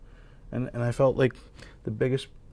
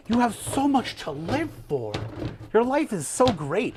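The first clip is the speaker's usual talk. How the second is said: mock cheerleader; 8 semitones higher than usual. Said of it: "When someone was like".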